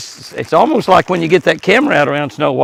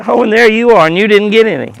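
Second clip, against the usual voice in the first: voicing the deer; 8 semitones higher, 5 dB louder than usual. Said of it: "He says".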